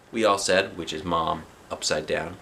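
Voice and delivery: usual speaking voice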